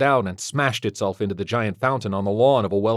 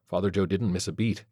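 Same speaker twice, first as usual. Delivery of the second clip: usual speaking voice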